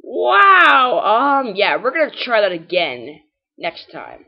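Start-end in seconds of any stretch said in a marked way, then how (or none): none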